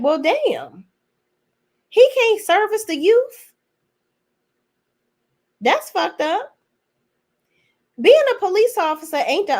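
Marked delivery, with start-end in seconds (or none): 0.0-6.6 mock outraged retort. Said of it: "I'm like".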